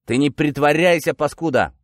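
high, irritated bark; scolding shout. Acted. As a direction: yell at a malingering prisoner. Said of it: "прикрикнул сержант".

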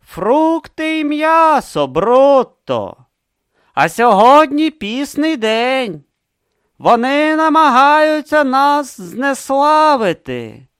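high, slow, loud reproach; stern reproach. Acted. Vorbis slowly scolding Brutha over the fast day.

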